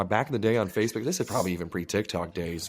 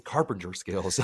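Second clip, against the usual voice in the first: mock accusing; 2 semitones lower, 4 dB quieter, slower than usual. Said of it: "I'm like".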